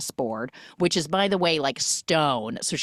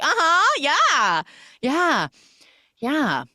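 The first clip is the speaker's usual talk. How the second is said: high, loud eager uh-huh yeahs; mock eager chorus of yeahs; eager agreeing; 12 semitones higher, 6 dB louder, slower than usual. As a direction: pile on agreement.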